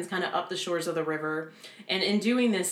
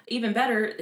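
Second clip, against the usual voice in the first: sarcastic; 6 semitones higher, 4 dB louder than usual. Real, not acted. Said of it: "I say that very sarcastically".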